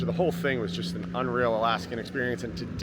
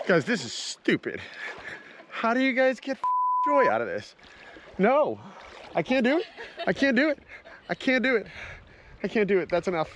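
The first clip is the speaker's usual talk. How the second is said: panicked pleading; 10 semitones higher than usual. Real, not acted. [name] failing to enter icy water.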